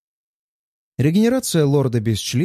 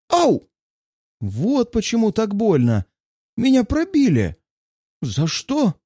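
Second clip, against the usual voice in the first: much higher mock pained wailing; hammed-up agony; 8 semitones higher than usual. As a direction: overact the pain for laughs. Narrator hams up being stabbed.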